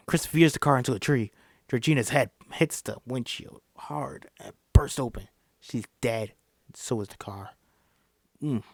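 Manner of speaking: plain reading voice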